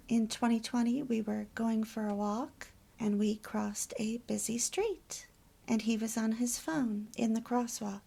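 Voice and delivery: ordinary talk, nothing marked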